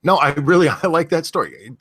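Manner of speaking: laughing, overdone sincerity. Real, not acted. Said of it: "That sounded even more sarcastic".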